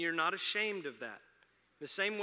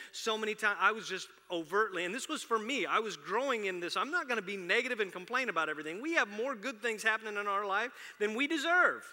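louder, higher, building fervour; full-force preaching; 8 semitones higher, 10 dB louder than usual. Acted. Pastor rallies the congregation about being thankful, preaching loud. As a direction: preach it out to the back row.

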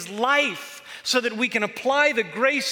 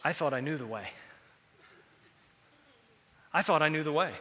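ordinary talk

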